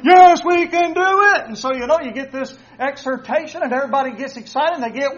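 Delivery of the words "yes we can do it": crowd cheer. Retold or imitated. Soldiers answering the orator, loud high cheer. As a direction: roar it back like a fired-up army. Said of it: "everybody gets all excited".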